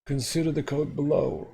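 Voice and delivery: measured textbook read-aloud. Acted. Reads the lesson text aloud.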